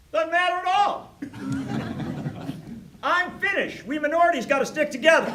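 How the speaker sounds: punchline as the stranger. Retold or imitated. Big Finnish stranger to Paul, loud amused punchline. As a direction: deliver the twist with a grin.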